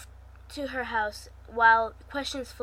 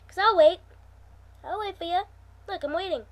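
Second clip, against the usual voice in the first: teasing; 7 semitones higher, 9 dB louder, faster than usual. Real, not acted. Teasing viewers to pause video.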